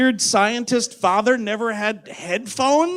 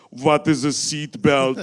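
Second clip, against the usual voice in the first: lightly voiced low, slow caveman; a hint of a baffled primitive, drawn out slowly; clueless caveman; 6 semitones lower, slower than usual. Caveman character puzzled on a plane, low slow bafflement. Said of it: "he's like".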